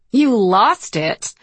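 high, drawn-out amused disbelief; infectious laughter. A narrator's reading. Sabrina questions her sister's lost voice, starting to laugh. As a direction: echo it slowly, about to crack up. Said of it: "Sabrina is convulsing with it too".

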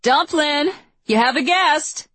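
high, loud calling mom voice; mom calling upstairs. Acted. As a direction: call up the stairs like a mom. Mom calls up the stairs.

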